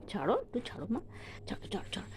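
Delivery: soft whispered pleading. Real, not acted. Parent to a toddler gripping her dress, whispered pleading.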